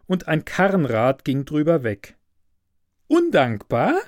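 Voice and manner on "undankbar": high, scornful echo; indignant daughter. A narrator's reading. Professor's daughter scorning the student, high indignant echo. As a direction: throw the word back at him. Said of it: "sagte das Mädchen".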